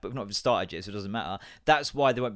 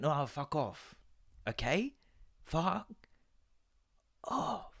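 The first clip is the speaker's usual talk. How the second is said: mock exasperated reply; 3 semitones higher, 7 dB quieter, much slower than usual. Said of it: "It's like".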